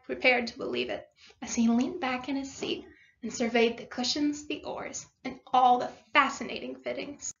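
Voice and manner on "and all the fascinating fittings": ordinary delivery, nothing marked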